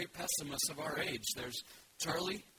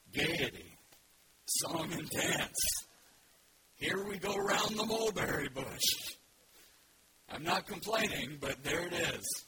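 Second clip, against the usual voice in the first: slow, louder, mocking gaiety from a gloomy donkey; sarcastic Eeyore; 2 semitones higher, 6 dB louder, slower than usual. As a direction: sneer at the idea of fun. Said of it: "you have to read it slowly".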